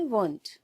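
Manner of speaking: won't said with a long o; contracted won't. Shown as a demonstration. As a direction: say won't, not want. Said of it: "इसको pronounce करते हैं".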